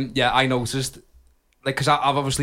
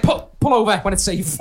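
high, louder stern instructor voice; driving instructor impression; 7 semitones higher, 6 dB louder than usual. [name] as driving instructor telling Cal to pull over, stern high voice. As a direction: play a strict driving examiner. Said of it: "It's such a good impression".

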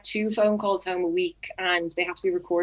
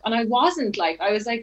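ordinary delivery

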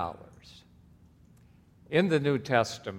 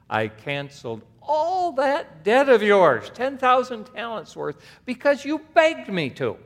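stern rebuke; 13 semitones higher, 10 dB louder than usual. Acted. Master rebuking the wicked servant, loud and high. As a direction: scold him with rising force.

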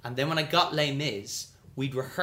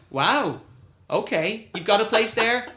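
higher, louder impressed doubt; mock surprise; 8 semitones higher, 6 dB louder than usual. Producer teasing the lying young actor, high and loud surprise. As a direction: sound impressed but unconvinced.

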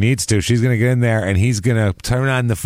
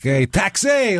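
hailing a cab; 10 semitones higher, much slower than usual. Pretend. Mock New Yorker hailing cab.

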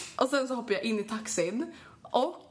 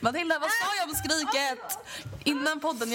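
laughing tease; 6 semitones higher than usual. Real, not acted. Teasing cohost about scream.

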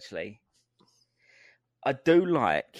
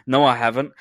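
excited; 9 dB louder than usual. Retold or imitated. Inner voice acted out.

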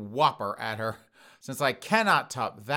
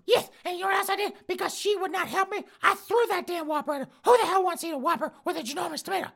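put-on old woman voice; faster than usual. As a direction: squawk it out like an outraged old lady.

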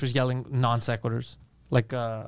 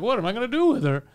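put-on exasperated boyfriend; 8 semitones higher than usual. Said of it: "the guy's like".

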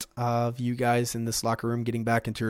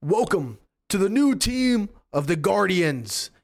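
slow, higher booming hero voice on the mic; hero's broadcast announcement; 8 semitones higher, 4 dB louder, slower than usual. Acted. Superhero welcoming the team over the stadium mic, booming and slow.

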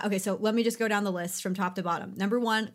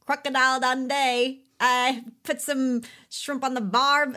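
loud, higher, hammed-up Aussie accent; bad Australian accent; 4 semitones higher, 5 dB louder than usual. Host quoting Aussie clichés for fun in a bad Australian accent. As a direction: overdo a cartoon Australian drawl. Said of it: "never gonna get the good Australian accent".

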